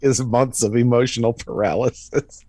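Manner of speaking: repeating the line through a laugh; amused laughing repeat. Real, not acted. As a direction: chuckle the words out.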